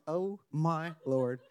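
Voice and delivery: a mortified half-gasp at a bold friend; shocked aside. Retold or imitated. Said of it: "and I'm like".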